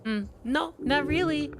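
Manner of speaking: put-on high, sly kid dodging; a kid pretending he knows nothing; high coy kid voice; evasive kid. Kid lying.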